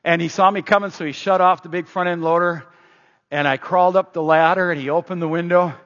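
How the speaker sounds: usual speaking voice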